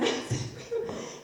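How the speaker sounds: short breathy burst of laughter; laugh, amused at being picked. Real, not acted. Narrator laughing at being chosen to read the letters.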